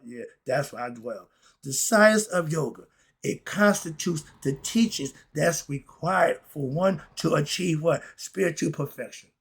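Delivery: ordinary talk, nothing marked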